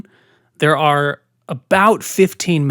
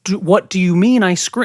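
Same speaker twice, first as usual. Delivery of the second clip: higher, louder re-enacted disbelief; baffled retort; 4 semitones higher, 4 dB louder than usual. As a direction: act out an incredulous comeback to mom. Child's baffled reply to mother.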